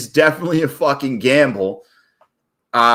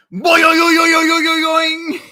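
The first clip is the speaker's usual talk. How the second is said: excited yelling; 4 dB louder than usual. Real, not acted. Big win on a trade.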